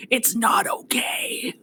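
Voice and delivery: slow, unvoiced aside; soft whispered secret over someone's head; mouthed whisper. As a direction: mouth it silently with just breath. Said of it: "He's mouthing over her head".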